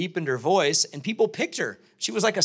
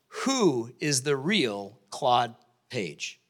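drawn-out question; 6 semitones lower, much slower than usual. Retold or imitated. Panel's task, drawn out.

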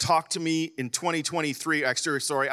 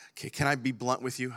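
usual speaking voice

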